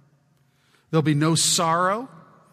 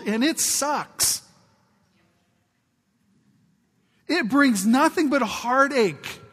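higher, urgent pleading to wake them up; fired-up exhortation; 6 semitones higher than usual. Real, not acted. Preacher urging his chilly congregation, fired-up and pleading. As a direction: push the crowd to get excited.

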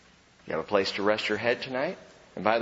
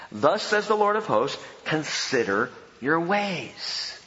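scripture reading; 5 semitones higher than usual. Acted. Reads Haggai scripture aloud.

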